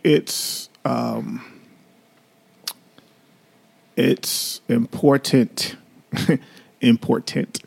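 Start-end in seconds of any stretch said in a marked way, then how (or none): 3.9-7.6 "important" slowly, syllable by syllable, each t sounded; mock-careful "important", stretched out with a laugh; every syllable pronounced. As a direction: imitate over-precise diction on "important".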